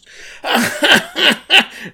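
loud, high, repeated cackle; imitated terrible laugh. Imitating the woman's laugh as she walks home, terrible cackle. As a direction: throw out a big hammed-up cackle. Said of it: "like that terrible laugh".

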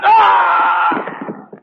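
loud high scream of terror; short piercing scream as the blow lands; sudden terrified scream. Acted. Victim attacked, cries out.

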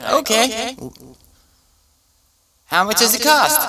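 high, eager question; curious viewer. Retold or imitated. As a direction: ask like a keen viewer. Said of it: "You're like".

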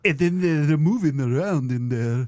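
hammed-up mock European accent; put-on foreign accent. Imitating the pool-ball swallower, hammed-up foreign accent. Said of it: "He had an accent".

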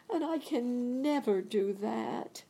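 put-on high, young girl's plea; lifted girlish voice; Dorothy's voice. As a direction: voice the little girl near tears. Dorothy speaks, quoted in the story.

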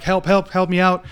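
yelling for help from the bottom of a hole; retold scream for help. Man stuck in a hole shouting up for help, mock yell. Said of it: "he's screaming".

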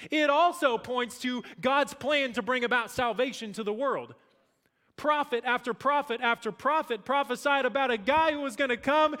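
loud, high preaching climax; rising to a shout from the pulpit; fiery preaching. Real, not acted. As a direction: drive it home at full force.

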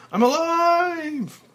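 very high, loud, slow shout of joy; joyful shout. Acted. Frank back safe, slow high joyful shout. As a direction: stretch out the joy. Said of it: "finally shouting with joy".

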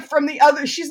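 ordinary talk

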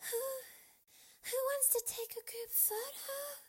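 squealed, very high character voice; high screamed call. A narrator's reading. Luz gathering everyone for a photo, high squealed call. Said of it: "Luz screamed".

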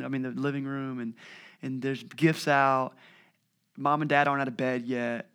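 ordinary delivery

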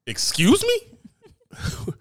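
very high, slow drawn-out disbelief; mock outrage. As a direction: stretch it out in shock. Outraged at not drinking water.

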